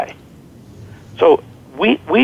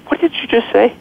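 high, startled question to the nurses; retold surprise; 7 semitones higher than usual. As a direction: caught off guard, asking again in disbelief.

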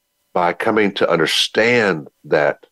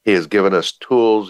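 ordinary delivery

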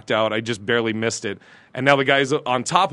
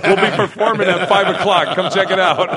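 higher, louder mock show plug; calling to a crowd; 7 semitones higher, 4 dB louder than usual. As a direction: pitch it out like a promoter hailing passers-by. Calls out plug to onlookers.

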